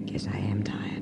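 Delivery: tired whisper. Acted. Tired woman leaning on him.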